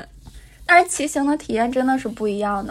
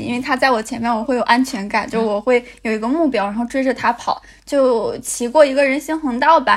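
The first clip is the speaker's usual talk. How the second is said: usual speaking voice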